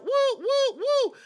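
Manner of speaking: mock crowd woo. Hyping Lyra's entrance, chanting.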